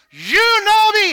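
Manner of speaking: high, loud crying out to God; shouted plea. Acted. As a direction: throw it up to heaven. Echoing Hezekiah's plea.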